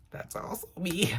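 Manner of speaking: whispered playful aside. Acted. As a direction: whisper it as a secret.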